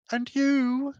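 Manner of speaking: high, grand creature voice; lofty, high creature voice; Quattro voice. Acted.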